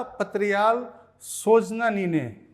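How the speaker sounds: slow, deliberate phrase reading; slow demo. Shown as a demonstration. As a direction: read it slowly so students catch it. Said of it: "तो इसको बोल देंगे बेहोश".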